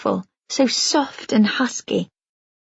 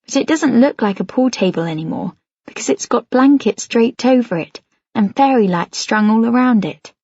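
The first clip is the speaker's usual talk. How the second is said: plain reading voice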